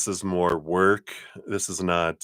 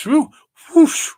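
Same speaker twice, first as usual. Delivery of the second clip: breath-heavy whoosh, second one louder; imitated whoosh; 10 dB louder than usual. Reader reacting to the fortune, playful whoosh noises. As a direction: make two whooshing noises, building.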